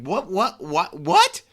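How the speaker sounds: mock outrage at the referee's call, rising on each what; retold disbelieving outburst. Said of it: "I'm like".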